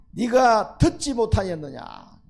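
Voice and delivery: loud, very slow challenge; booming reproach from above; divine rebuke. Acted. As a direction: thunder the question down at him. God rebukes the boasting king.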